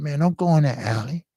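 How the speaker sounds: high, pleading warning to his friend; frightened plea. Retold or imitated. Robbed man begging friend to stay out of alley, high and scared. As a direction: beg him not to go.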